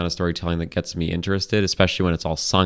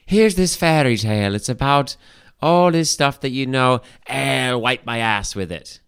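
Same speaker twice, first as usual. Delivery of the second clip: raised, slow mocking storyteller voice; mock narrator; 5 semitones higher than usual. Mocking the first Shrek's brash attitude, higher and slower. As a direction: sneer it like a brash fairy tale.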